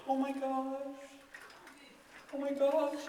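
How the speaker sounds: high, soft mock dismay; imitated shock. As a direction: imitate a girlfriend's hushed, high horror. Girlfriend seeing the wrecked car.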